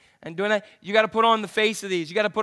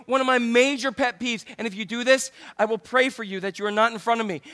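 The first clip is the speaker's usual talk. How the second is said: ordinary talk, nothing marked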